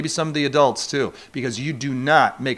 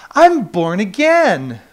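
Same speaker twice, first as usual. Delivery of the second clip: slow, louder, beaming announcement; imitating Sue; 6 semitones higher, 8 dB louder, much slower than usual. Preacher imitating Sue announcing her conversion. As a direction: draw it out proudly. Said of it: "She goes".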